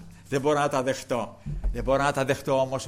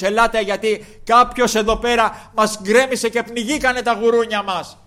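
higher, much louder outcry; alarmed shout; 7 semitones higher, 10 dB louder than usual. Acted. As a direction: call out to the town in alarm. Herdsmen call townspeople. (δυνατή, ταραγμένη φωνή των βοσκών)

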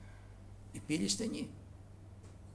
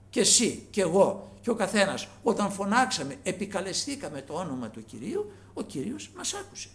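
ordinary delivery

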